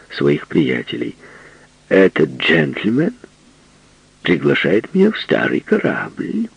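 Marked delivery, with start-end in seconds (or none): 1.9-6.5 high, slow affected prissiness; mock prim tone. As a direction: put on a coy lady act. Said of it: "притворно жеманным тоном".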